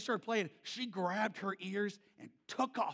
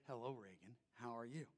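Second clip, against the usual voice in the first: much softer, lower and hushed; quiet tender greeting=75; soft hello; 6 semitones lower, 14 dB quieter than usual. Retold or imitated. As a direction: greet her gently. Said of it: "And I'd say".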